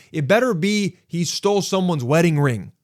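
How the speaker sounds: high, emphatic exasperated rant; indignant complaint, hammering the key words. Acted. Exasperated rant about the petty theft.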